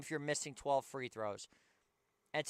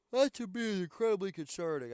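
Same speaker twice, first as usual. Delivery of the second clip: yawning while talking; words stretched by a yawn; 5 semitones higher than usual. Real, not acted. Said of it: "I'm sorry if I'm yawning".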